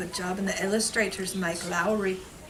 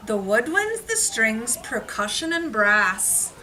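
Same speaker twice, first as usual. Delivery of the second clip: bright, higher, louder read-aloud; lively story reading; 5 semitones higher, 4 dB louder than usual. A narrator's reading. Teacher reading the rhyming book aloud to children.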